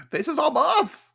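amused disbelief. Real, not acted. Echoing her co-host's recap in high amazed disbelief. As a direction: repeat it in delighted shock.

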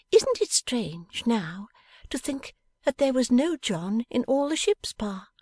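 put-on a bright young wife teasing her Pa; young Bella. Bella speaks to her father.

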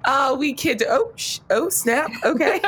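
high, startled backtracking; mock alarm, half laughing; flustered backpedal. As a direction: jump up in pitch as the joke goes wrong. Acted sudden backpedal after teasing.

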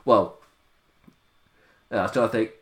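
usual speaking voice

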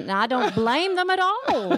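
playful, lilting Southern drawl; put-on Southern accent, hamming it up. Shown as a demonstration. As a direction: stretch the last words in a Southern accent. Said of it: "that's how you do the Southern accent".

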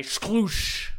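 high, whooshing splash noise; imitated splash. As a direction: mimic liquid sloshing with the mouth.

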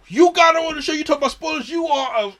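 high, loud mock outrage; mocking internet troll. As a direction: shriek it like an angry online commenter. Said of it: "People really go".

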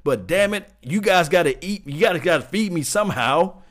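a touch of an indignant player; demanding player. Retold or imitated. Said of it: "What you say is".

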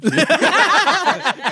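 big high-pitched laugh; loud high laugh. Real, not acted. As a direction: cackle loudly and high. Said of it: "that laugh is exactly why".

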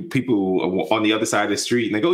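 ordinary talk

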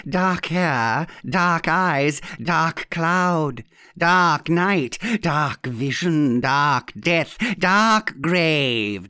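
ominous incantation, each pair set apart; theatrical old aunt. Acted. Aunt trying to thrill Mister Withers, theatrically ominous. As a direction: build the list of darks to a dramatic climax. Aunt's dramatic list in the story.

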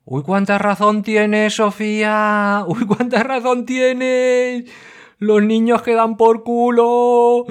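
high, slow, hammed-up fawning agreement; mock agreement. Host mocks a listener's rant, high and dragged out. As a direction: drag it out in a sing-song mock voice.

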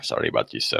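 usual speaking voice

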